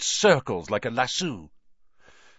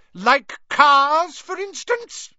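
high, loud pointed question; spring the trap on him; pointed wife; 9 dB louder, slower than usual. Acted. Molly confronting Arthur about the car, high and pointed.

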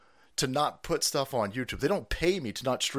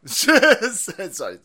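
laughing sorry; 5 semitones higher, 10 dB louder than usual. Real, not acted. Laughing apology.

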